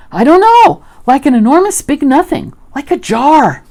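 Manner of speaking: high, loud, flustered description; stammering, worked-up little creature; Piglet. A narrator's reading. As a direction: grope for words in excited alarm. Frightened Piglet describes the monster.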